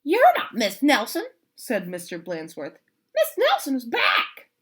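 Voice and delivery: high, loud huffy principal; principal's voice. Acted. Principal on the phone refusing to believe, high and loud. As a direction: insist like a stuffy principal.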